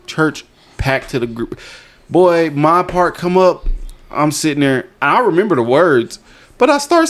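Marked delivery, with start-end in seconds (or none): none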